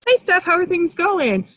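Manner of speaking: a hint of a pushy salesperson opener; overeager pitch. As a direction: sound a bit too keen, like you're about to sell. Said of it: "I wouldn't walk up to Steph and be like".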